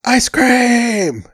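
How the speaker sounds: high, drawn-out scream; screaming demand. Retold or imitated. Student yelling from the freezer, high drawn-out scream. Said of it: "and screams".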